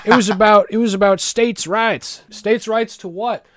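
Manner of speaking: high, drawn-out Southern drawl; Southern accent. Acted. Arguing about states' rights, high mock Southern accent. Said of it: "do a proper Southern accent".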